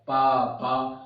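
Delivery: पा said twice, low in pitch; syllable demo. Shown as a demonstration. Teacher shows lips opening for the sound, syllable demo of पा. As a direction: demonstrate पा as two separate low syllables. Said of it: "पा का उच्चारण होगा".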